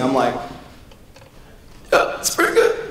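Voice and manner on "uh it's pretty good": choked-up. Retold or imitated. Hiding tears.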